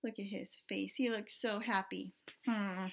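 ordinary delivery

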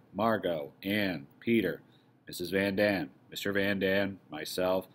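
usual speaking voice

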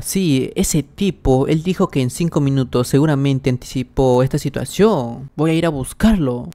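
usual speaking voice

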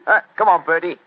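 loud, high Cockney workman; brash Cockney council man at the door; Cockney accent. Acted. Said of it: "My Cockney accent".

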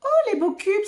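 high admiring exclamation; exclaiming. A narrator's reading. Célestin discovers the building blocks, exclaiming. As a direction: exclaim in delight, pitched well up. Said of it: "s'exclame Célestin".

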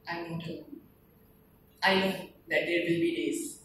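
very soft, halting farewell verse; resigned poem recitation. Acted. Dying soldier consoling his parents, softly resigned. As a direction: drop to a hush, pausing between phrases. Poem's soldier accepts death.

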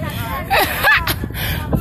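high airy laugh. Real, not acted. Vlogger laughs at the camera, high giggle. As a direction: giggle mid-hype. Laughing while filming live.

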